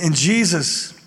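ordinary talk, nothing marked

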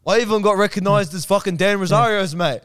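high, loud mock complaint; imitated complaining. Friend quoting his complaint about being recognized, mock complaining. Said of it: "You complained on the".

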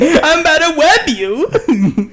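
put-on high, slow, laughing little boy voice; little kid voice. Mocking a kid in a Spider-Man costume, high and slow with laughter. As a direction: squeak it out like a tiny superhero kid. Voicing a little boy's line.

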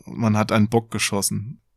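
usual speaking voice

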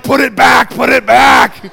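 loud, high comic yelling; mock panicked shout. New dad to doctors over wailing baby, mock panicked shout. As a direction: shout it in exaggerated alarm.

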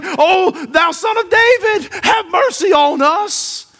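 high, drawn-out shout for mercy; crying out. Acted. Blind men calling after Jesus, crying out. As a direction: call out slowly and fervently.

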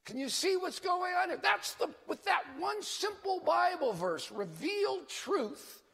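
high, fervent preaching. Real, not acted. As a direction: press the point with fervour.

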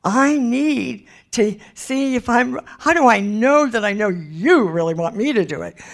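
high, whiny tearful pleading; imitated crying. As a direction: act out someone crying as they beg God. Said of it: "somebody that's still crying and".

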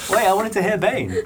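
high squealing laughter through the words; laughing hard. Real, not acted. Player cracking up at the Bane joke, laughing. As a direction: crack up while you speak.

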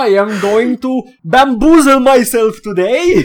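a gleeful troll announcing his scheme; mock supervillain troll; hammed-up troll. Voicing the troll poster.

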